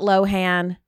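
full "han" ending on Lohan; mistaken pronunciation. Shown as a demonstration. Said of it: "Not Lohan".

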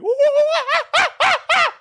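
high monkey whoops building to loud shrieks; imitated monkey. Monkey in the distance on sleep sounds, imitated screeching. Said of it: "like, a monkey masturbating in the distance".

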